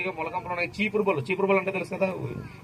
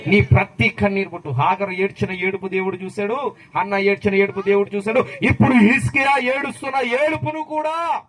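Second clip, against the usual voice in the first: loud, mounting sermon cry; rousing preacher in full flow; full-force preaching; 3 semitones higher, 6 dB louder than usual. Real, not acted. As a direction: build to a loud, raised peak.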